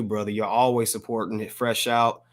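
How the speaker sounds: normal talking voice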